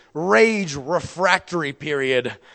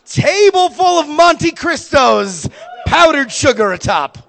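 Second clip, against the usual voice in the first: higher, louder, slow; exaggerated reveal; 12 semitones higher, 9 dB louder than usual. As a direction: draw out the punchline.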